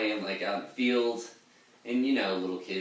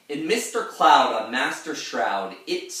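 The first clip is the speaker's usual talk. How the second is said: put-on slow, louder dramatic old sailor reading; Ancient Mariner voice; 5 semitones higher, 7 dB louder than usual. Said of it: "that's the end of the Ancient Mariner saying that".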